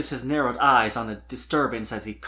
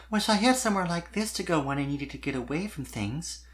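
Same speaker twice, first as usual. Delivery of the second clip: wistful admission; 5 semitones higher, 4 dB quieter than usual. A narrator's reading. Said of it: "she admitted with a small sigh".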